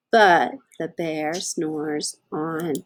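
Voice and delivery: slow, drawn-out storybook refrain. Acted. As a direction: let the refrain linger slowly. Refrain read to children.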